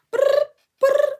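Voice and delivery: high, loud ring tone imitation; imitated phone ring. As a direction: mimic a phone ringing, high.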